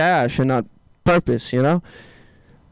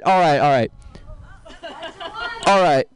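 usual speaking voice

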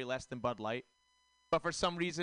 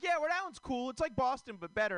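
much higher, hammed-up cheerful voice; mock cheerful locals; 9 semitones higher than usual. Comic imitating others praising Rhode Island, high mock cheer. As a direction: ape the other comics gushing. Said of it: "And they're like".

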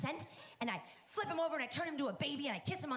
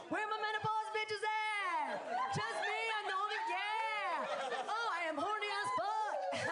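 rowdy call-out; 10 semitones higher than usual. Acted. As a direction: hype up the crowd, much higher.

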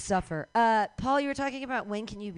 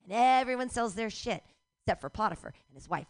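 ordinary talk

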